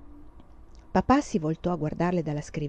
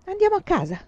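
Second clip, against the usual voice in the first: decided answer; 11 semitones higher than usual. A narrator's reading.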